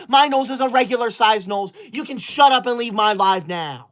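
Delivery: normal talking voice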